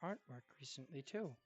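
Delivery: soft, halting, lightly acted voice; unwell grandson. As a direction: share your new hobby quietly and hesitantly. Voices Az while sketching.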